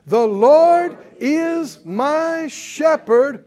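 The Lord is my shepherd, drawn out loud and high. Shown as a demonstration. Preacher leading the congregation, repeating it loud and slow. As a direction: shout it back even bigger, very slowly. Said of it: "Come on, say it again".

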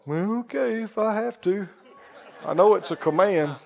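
put-upon sigh of obedience; reluctant, grudging compliance. Acted. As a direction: give in grudgingly under your breath. Acts out reluctantly obeying the command.

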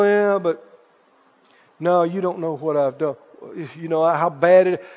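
low, subdued doubting reply; imitating doubtful people. People look up unsure they can be forgiven, doubtful and subdued. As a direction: voice a downcast person's hesitant objection.

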